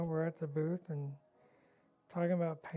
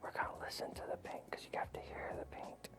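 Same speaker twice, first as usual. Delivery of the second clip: hushed breathy whisper, faster; 14 dB quieter than usual. Retold or imitated. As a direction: whisper it like a secret. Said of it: "talking like this".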